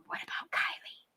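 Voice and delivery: hushed, barely-there whisper. Acted. Host imagining someone in the crowd quietly asking after Kylie, whispered. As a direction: say it under your breath, no voice. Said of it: "to just at least whisper".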